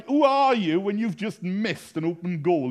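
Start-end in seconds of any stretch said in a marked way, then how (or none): 0.1-0.8 loud, high football-chant taunt; mock crowd shout. Fans at a player who missed an open goal, mock shout. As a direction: imitate fans taunting from the stands. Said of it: "sixty thousand fans all shouting".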